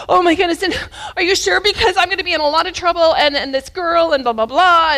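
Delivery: high, loud, rushed acted panic; imitated frantic worry; anxious panic. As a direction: babble like a boy working himself into a panic. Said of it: "getting anxious about whatever they're talking about".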